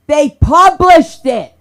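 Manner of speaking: high, loud and slow, stretched out; amazed outburst. Real, not acted. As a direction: let it burst out in disbelief. Shock at the letter being printed.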